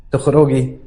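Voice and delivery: mocking one-word question from the nurse. A narrator's reading. Nurse answering the patient who asks to leave, mocking. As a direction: throw the question back with scorn. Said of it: "ردت عليا بتريقة وقالت".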